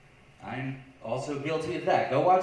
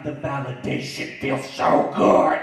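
savoring; 9 dB louder, slower than usual. Acted. Savoring the validation.

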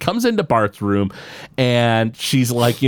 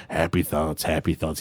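put-on happy thoughts, happy thoughts said lower and softer, a grumbly old man; old Jasper muttering; 9 semitones lower, 8 dB quieter than usual. Jasper grudgingly repeating his happy thoughts, low and soft. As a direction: mumble happy thoughts under your breath like a weary old man. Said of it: "Jasper's walking down the street and he's like".

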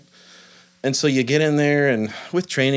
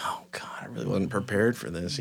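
soft unvoiced whisper, much softer; dread whisper; 3 semitones lower, 9 dB quieter than usual. Dad whispering his dread as his son pushes him in.

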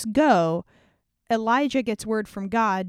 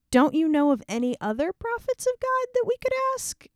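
high uneasy voice; 11 semitones higher than usual. Acted. Jehoshaphat anxiously asks Ahab for another prophet, high and uneasy. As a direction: pitch way up, nervous and doubtful.

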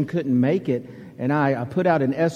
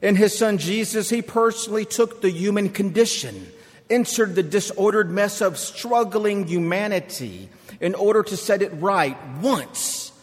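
measured pulpit reading, rising on key phrases; scripture reading. Acted. Preacher reading Romans to the congregation, measured pulpit read. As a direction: read the passage with weight and lift.

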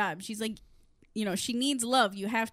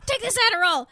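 pushy monster voice; 8 semitones higher, 8 dB louder, faster than usual. Retold or imitated.

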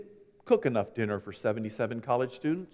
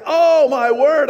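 much higher, much louder, slow mock dismay; exasperated gasp; 12 semitones higher, 11 dB louder, slower than usual. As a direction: throw up your hands in mock horror. Exasperated parent voiced.

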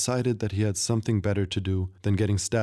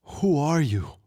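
stuttered; 6 semitones higher, slower than usual. A narrator's reading. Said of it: "the tavern owner stuttered".

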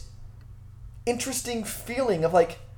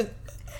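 silent heaving laugh; convulsing laugh without sound; stifled giggles; 17 dB quieter than usual. Real, not acted. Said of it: "doing the dry heave of laughing where you're not making noise".

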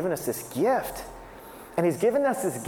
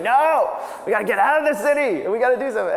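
higher and louder, excited wife pleading; eager insistent wife; 7 semitones higher, 4 dB louder than usual. Retold or imitated. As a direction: push hard for the getaway, voice up.

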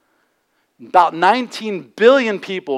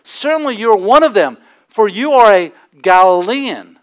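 loud, higher charge voicing bystanders; raised-voice retold accusation; crowd's accusation; 5 semitones higher, 6 dB louder than usual. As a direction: accuse him emphatically, drawing out the last words. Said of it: "now the crowd's chiming in".

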